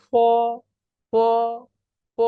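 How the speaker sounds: long ফ from slightly inside the mouth; Pinyin f as ফ. Shown as a demonstration. Teacher demonstrates f to learners, drawn-out ফ from inside. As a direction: bring a held ফ from within the mouth. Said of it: "একটু মুখের ভিতর থেকে ফটা আসবে".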